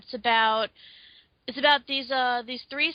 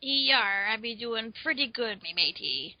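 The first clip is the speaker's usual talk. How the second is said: pirate voice. Pretend. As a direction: growl it like an old sea dog. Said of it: "Nah, I'm just kidding".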